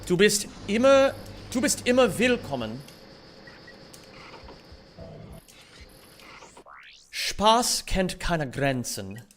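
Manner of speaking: "Du bist immer willkommen" in careful learner's German. Shown as a demonstration. Streamer seriously trying to say "willkommen" well in German, careful. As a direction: say "Spaß kennt keine Grenzen" carefully, word by word, in German. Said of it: "seriously try to say it well".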